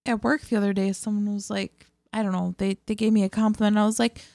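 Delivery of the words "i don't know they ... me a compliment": normal talking voice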